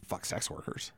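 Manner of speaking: drops to a sudden embarrassed whisper; hushed, sheepish whisper. Real, not acted. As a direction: whisper the awkward bit so nobody hears. Embarrassed aside about sex tourism.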